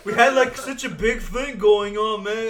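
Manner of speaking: put-on loud slurred drunk rant; belligerent drunk. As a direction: rant like a drunk picking a fight. Acting Obi-Wan confronting Anakin.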